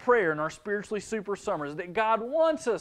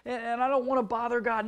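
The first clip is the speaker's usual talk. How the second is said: put-on higher-pitched worried kid; child's objection; 6 semitones higher than usual. Child objecting to Brother Ethan, higher-pitched mock kid voice. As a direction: voice a child's mild protest, pitched up. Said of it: "You say".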